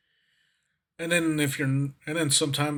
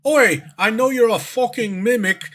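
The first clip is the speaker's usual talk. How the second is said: hammed-up British "Oi", louder and higher; mock British guy; 7 semitones higher, 5 dB louder than usual. British guy calling out a mimic, mock indignant British accent. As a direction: put on a British challenge. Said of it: "it's like a British guy going".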